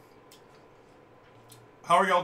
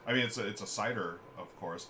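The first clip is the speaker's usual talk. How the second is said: normal talking voice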